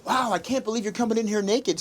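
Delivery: high, put-on teasing voice; mock announcing. Husband joking on Google Meet as his wife walks in, high mock tease. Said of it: "I was like".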